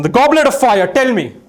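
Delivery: mock summoning. Mentalist on stage commanding the goblet of fire. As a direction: call on the goblet like a stage oracle.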